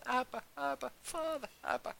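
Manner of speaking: very high cry without extra volume. Retold or imitated. New believer crying out to God in a high voice. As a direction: plead upward in a high, held-back cry. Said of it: "I was crying out to God".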